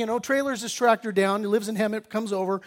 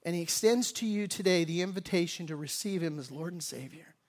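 quiet, earnest invitation to the congregation; soft altar call; 4 semitones lower, 7 dB quieter than usual. Acted. Pastor invites listeners to receive Jesus, soft and earnest. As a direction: lower the voice and plead gently.

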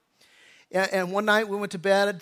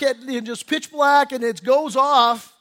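ordinary talk

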